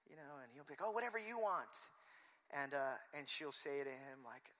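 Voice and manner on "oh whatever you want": higher-pitched, offhand giving in; imitated breezy people-pleasing; airy deferral. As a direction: toss it off as the accommodating husband. Acts Bill's airy deferral.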